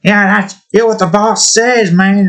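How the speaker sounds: eager goon. Acted. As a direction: cheer on the boss like a happy henchman. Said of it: "Why did I do this voice?".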